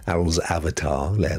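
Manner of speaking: normal talking voice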